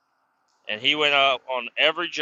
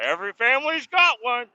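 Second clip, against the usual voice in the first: mocking the great uncle; 11 semitones higher than usual. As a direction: ham up the proud family asshole. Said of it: "He's like".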